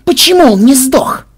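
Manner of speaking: high, loud, panicked demand; frantic yelling. A narrator's reading. Ksenobait fleeing, demanding answers from Banzai, in a high yell. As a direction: yell the questions at the top of your range. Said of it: "орал на бегу Ксенобайт".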